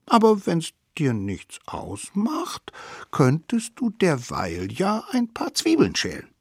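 high sly whisper; high, hushed scheming kids. Acted. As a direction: coax the mammoth in a hushed kid voice.

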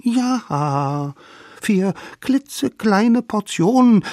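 very high, loud tiny voice; wheedling little savages. A narrator's reading. Savages play down the bite to mammoth, wheedling. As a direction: make it sound teeny and harmless. Savages minimise their crime.

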